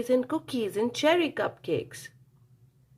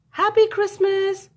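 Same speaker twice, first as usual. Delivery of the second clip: bright high shout of holiday cheer; shouted greeting; 12 semitones higher, 9 dB louder than usual. A narrator's reading. Squirrel shouts a greeting to badger, loud and high. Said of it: "shouted Squirrel".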